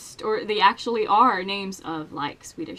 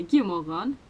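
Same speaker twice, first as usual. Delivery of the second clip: God morgon in a Swedish accent with throaty r; regional Swedish accent; 4 semitones lower than usual. Shown as a demonstration. Swede showing how some others say it, regional Swedish accent. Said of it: "some people might say".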